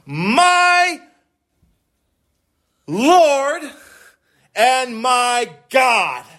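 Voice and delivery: slow, ringing cry, high and stretched out; awed exclamation. Acted. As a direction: let each word swell with awe. Preacher quoting Thomas's exclamation.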